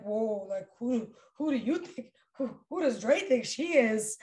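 mock someone taken aback and offended; stammering mock disbelief; affronted pushback. Acts out others' affronted pushback.

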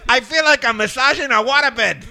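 put-on high, loud caricatured Chinese accent; hammed-up Chinese masseur; mock Chinese accent. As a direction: complain in a fake Chinese accent. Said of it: "everybody can do a Chinese accent".

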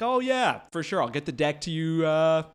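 lightly put-on corporate job interview voice. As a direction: sound like a polished professional on a call.

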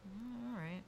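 quiet, doubtful murmur; skeptical inner thought. Acted. Imagined onlooker doubting a stated age, skeptical murmur. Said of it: "they're gonna think".

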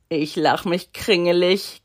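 ordinary delivery

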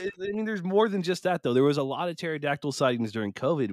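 normal talking voice

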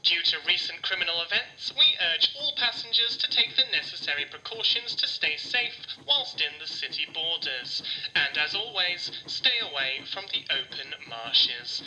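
conductor's announcement. Acted. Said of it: "crackle of the conductor's announcement".

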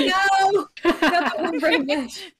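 high, drawn-out laughing protest; mock horror. Co-host protesting a friend's running joke, mock horror. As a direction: wail it in playful dismay, stretching the words.

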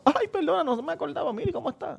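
lightly voiced coy flirt; mock flirt. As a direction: coyly fake being sorry, voice up. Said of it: "después tú guardas el número y dices".

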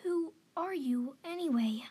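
a touch of nerves before an intimidating elder; slightly trembling. A narrator's reading. Young cat asking the intimidating old cat, slightly trembling. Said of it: "slightly trembling".

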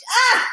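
sudden high loud excited shriek; excited yelp. Real, not acted. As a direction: squeal with delight at the name. Excited at remembering Coach.

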